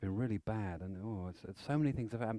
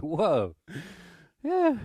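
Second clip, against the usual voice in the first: martial arts whoosh; 8 semitones higher, 5 dB louder than usual. Retold or imitated. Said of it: "He's like".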